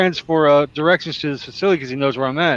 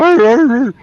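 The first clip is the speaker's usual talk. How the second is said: high loud mock dog growling "arr"; imitated dog growl; 10 semitones higher, 6 dB louder, slower than usual. Dog pestering him, imitated.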